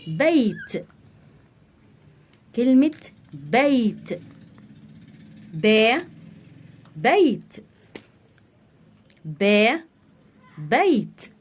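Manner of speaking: "بيت" and "با" sounded out slowly; teacher sounding out "با" then "بيت" for children; syllable drill. Shown as a demonstration. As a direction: break "بيت" apart, then say it whole. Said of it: "كلمة مثلًا".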